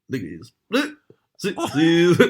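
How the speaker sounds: imitating a garbled bingo caller; sing-song caller call, rising. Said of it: "He'd go".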